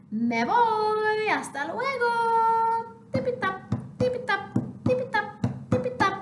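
high sing-song goodbye; hurried little chick. Acted. Half chick leaves the wind behind, high sing-song. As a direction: trip away chanting tipitap.

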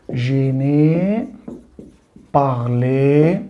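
French "جي ني بارلي" drawn out very slowly; teacher slowly modeling the French negation for students. Shown as a demonstration. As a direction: stretch each French syllable slowly for the class. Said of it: "خد بالك بقى في النفي".

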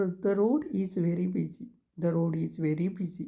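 each word spaced out for writing; slow dictation. Shown as a demonstration. Teacher dictating to students, very slow word by word.

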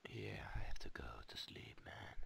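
sleepy whisper; mock-sleepy hushed voice. Mock sleepy whisper after bedtime remark.

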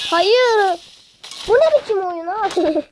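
high, loud cry of protest; frustrated shout. Real, not acted. Gamer dying right after start, loud high protest. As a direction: yell it at the unfair game.

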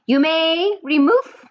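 the witch queen orders her coven, loud and slow; Grand High Witch shout. Acted. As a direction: command the room like a harsh witch queen. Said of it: "she shouted".

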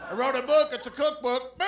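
mock stand-up delivery, high and loud; Jeffrey Dahmer. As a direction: play a bad comic punching out his joke. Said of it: "Jeffrey Dahmer jokes by Jeffrey Dahmer".

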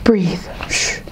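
hushed comfort; soothing whisper. Acted. Soothing crying student.

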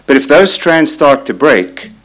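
ordinary delivery

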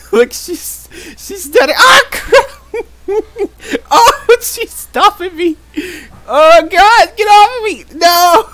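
high, loud frantic cries, half laughing; panicked screaming. Real, not acted. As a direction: scream to get loose from her grip. Trapped and eaten in game.

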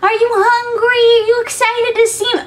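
put-on very high, slow baby talk. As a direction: greet the pets in baby talk. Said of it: "full on baby talk".

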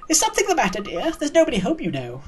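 put-on high-pitched, swooping old lady voice; kindly old neighbour. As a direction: play a nosy, kindly old woman.

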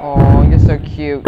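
loud, drawn-out mock coo; exaggerated fawning over someone cute. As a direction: coo it slowly and loudly, teasing. Mock-cooing over the other player.

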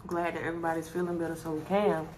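eating between words; talking while chewing. Real, not acted. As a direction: mouth partly full. Seen 1: Hand to mouth at -2; closed-lip chewing between words until about 5s.

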